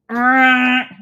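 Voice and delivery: mock rusty-hinge screech; dishwasher creak. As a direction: make the grinding noise of an unoiled dishwasher. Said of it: "really loud sound".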